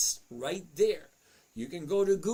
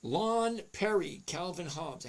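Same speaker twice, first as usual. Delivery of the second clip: ordinary talk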